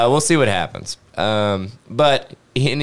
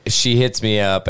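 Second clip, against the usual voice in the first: ordinary delivery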